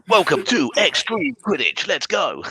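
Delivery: high, slow, booming sports announcer; announcer hype. Acted. Announcer welcoming the crowd to Extreme Quidditch in a big hype voice. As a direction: pump up the crowd like an arena announcer.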